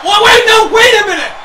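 loud, high, panicked commentary shouts; frantic disbelief. Real, not acted. Commentator begging the action to wait, shouting frantically. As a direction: yell as the match turns suddenly.

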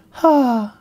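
high airy fairy sigh. Shown as a demonstration. Demo of a fairy sighing for listeners. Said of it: "a fairy sighing".

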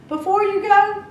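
high, loud warning; witch warning. A narrator's reading. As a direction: stop her with a sharp warning. Witch warns Cinderella.

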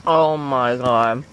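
a long, low, drawn-out oh my god; slow low savoring. Real, not acted. Tasting the nougat, a slow low moan.

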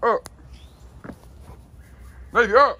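high, loud order; loud command. Real, not acted. Owner ordering Navy up, loud command. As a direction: snap the command at the dog.

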